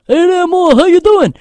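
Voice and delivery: high, loud hailing voice; Jersey the tree. Acted. Tree greeting a friend in the pasture, loud put-on voice. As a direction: call out a hearty put-on hello from a tree. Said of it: "he's talked to me before. He's like".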